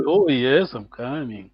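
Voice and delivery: drawn-out "Elo", sinking away softly; acted answer. Teacher playing someone called to come, acted unhurried answer. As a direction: answer a call with a long, falling reply. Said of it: "Are you, are you coming?".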